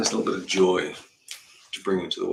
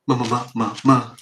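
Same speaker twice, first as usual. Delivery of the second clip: rising ma ma vocal drill; voice warm-up drill; 3 semitones higher, 9 dB louder than usual. Shown as a demonstration. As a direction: loosen the voice on ma. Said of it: "Voice training".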